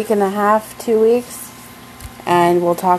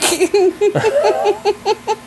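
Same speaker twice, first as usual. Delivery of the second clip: giggling high and breathy; a bright burst of laughter; 12 semitones higher than usual. Real, not acted. Laughs after her quote.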